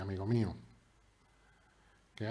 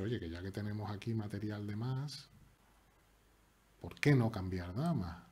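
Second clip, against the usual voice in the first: ordinary talk, nothing marked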